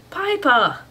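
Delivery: high, loud whine of the name; complaining. A narrator's reading. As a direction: protest as if betrayed. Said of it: "complained Danny".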